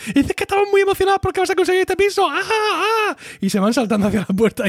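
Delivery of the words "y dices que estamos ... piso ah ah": fast, very high mock-excited squeal; faked excited screaming. Host acting the women covering up to Ross, fake-excited shrieking. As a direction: gush in a rush, then squeal.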